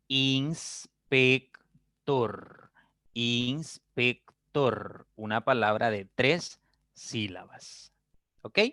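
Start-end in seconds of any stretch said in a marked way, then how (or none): 0.1-2.7 "inspector" very slowly, syllable by syllable. Shown as a demonstration. Teacher splitting the word for the class. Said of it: "Entonces tendré". 3.1-5.1 "inspector" very slowly, syllable by syllable. Shown as a demonstration. Teacher splitting the word for the class. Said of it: "Entonces tendré".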